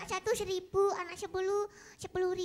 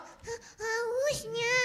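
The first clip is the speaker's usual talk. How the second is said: fake crying; much slower than usual. Puppet fakes crying from thirst.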